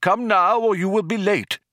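put-on high, loud, urging old man; calling out. A narrator's reading. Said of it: "called the old man".

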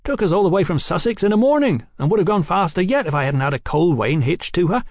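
put-on high, excited young man's voice; boastful young brother. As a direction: brag breathlessly fast about your new machine.

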